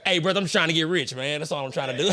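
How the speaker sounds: ordinary talk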